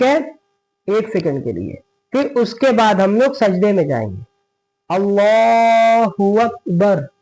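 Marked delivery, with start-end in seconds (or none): 4.9-7.1 very slow, long-held takbir; prayer cadence; takbir recited. Shown as a demonstration. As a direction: stretch Allahu out for prostration. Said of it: "कहते हुए".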